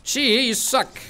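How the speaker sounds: loud, high insulting shout; angry yell. Real, not acted. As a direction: yell at a bad teammate. Insulting a teammate's attack.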